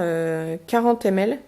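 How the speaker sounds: normal talking voice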